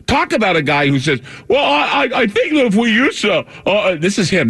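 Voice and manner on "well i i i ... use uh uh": high, halting, stammering mock voice; Bill Gates impression. Host mocking Bill Gates on air, high stammering impression. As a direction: stumble through it in a high put-on voice. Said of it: "this is how he sounds".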